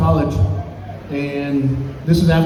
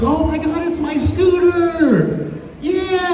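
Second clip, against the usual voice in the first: very high, stretched-out mock squeal; imitated overjoyed child; 12 semitones higher, much slower than usual. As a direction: play a little girl thrilled with her gift. Acts small daughter unwrapping a scooter.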